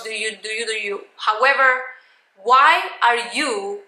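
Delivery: usual speaking voice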